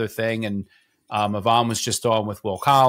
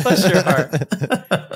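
put-on slow, laughing Southern accent on "Bless your heart"; laughing mock Southern drawl; 3 semitones higher, much slower than usual. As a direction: stretch it out in a hammed-up Southern accent. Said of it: "with a smooth Southern accent".

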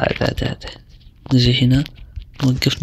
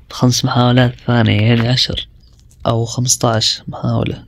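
ordinary talk, nothing marked

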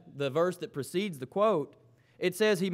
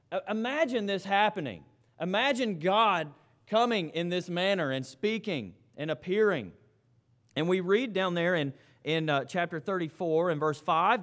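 normal talking voice

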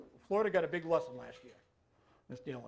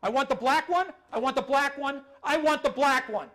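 loud, high, repeated demand in a put-on old man voice; 9 semitones higher, 10 dB louder than usual. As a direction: nag like a confused old man who won't let go. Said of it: "Here's Mister Jones".

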